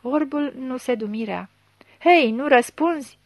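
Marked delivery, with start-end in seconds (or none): 2.0-3.2 louder and higher, prodding; impatient demand. A narrator's reading. Chief presses the baffled blind man, impatient demand. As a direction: demand an answer from the silent suspect.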